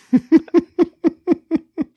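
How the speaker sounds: loud high burst of laughter; real laugh. Real, not acted. About squeaking toys in the store, loud laughter. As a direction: laugh at your own silliness.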